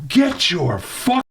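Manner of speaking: high, drawn-out angry demand; retold screaming. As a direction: re-enact a customer screaming for the manager. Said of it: "I started screaming".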